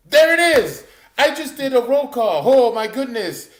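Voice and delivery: loud, high-pitched burst of excitement; excited shout. Real, not acted. Excited greeting to live chat.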